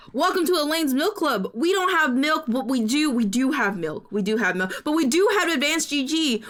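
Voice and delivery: upbeat sponsor pitch voice; ad read. Acted. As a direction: sell it like a cheesy ad.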